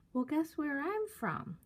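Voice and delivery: high, playful mimicked singsong; sing-songy. Older man starting guessing game with girls, mock sing-songy. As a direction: imitate him talking to children, teasing. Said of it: "sing-songy kind of a attitude".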